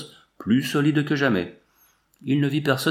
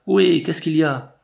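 higher, louder call up from below; 6 semitones higher, 5 dB louder than usual. A narrator's reading. Old miner calling up from the tunnel, loud call. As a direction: shout up the mine shaft, ohé. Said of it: "et Boucher cria".

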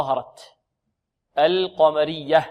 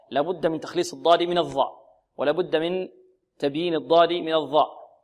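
ordinary talk

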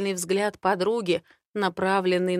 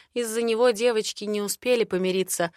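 plain reading voice

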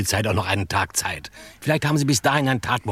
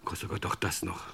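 unvoiced, much softer dismay; whispered dread on hearing of the visit; horrified whisper; 5 semitones lower, 10 dB quieter than usual. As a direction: breathe it out in dread. Aghast aside at bad news.

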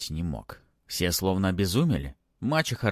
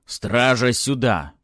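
raised authoritative summons; loud and commanding; 4 semitones higher, 7 dB louder, much slower than usual. A narrator's reading. Baron summons his guards, loud and commanding. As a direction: call out with lordly force. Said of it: "неожиданно громко и властно позвал стражу".

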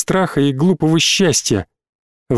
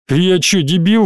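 usual speaking voice

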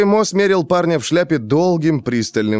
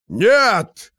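shout; 9 semitones higher than usual. A narrator's reading. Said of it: "закричал торговец".

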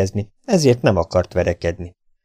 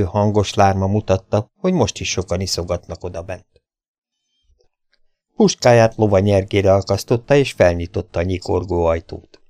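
usual speaking voice

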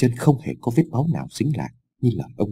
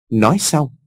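plain reading voice

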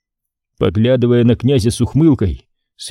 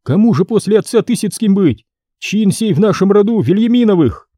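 put-on angry young boyar; 9 semitones higher than usual. Ivan argues angrily.

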